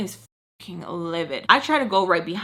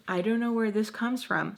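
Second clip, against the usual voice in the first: ordinary talk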